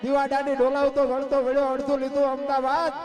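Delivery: loud, high-pitched chanted folk verse; rousing poem recital. Acted. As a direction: belt out the verses on a high, driving, level pitch. Recites a folk poem about the earthquake.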